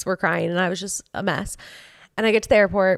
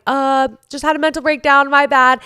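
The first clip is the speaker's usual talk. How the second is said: sheepish excuse; 6 semitones higher, 6 dB louder than usual. Retold or imitated. Retelling awkward excuse to waiting women.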